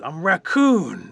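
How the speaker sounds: lightly voiced little creature announcing itself; little raccoon. Singed raccoon answers Bear, lightly acted little creature voice.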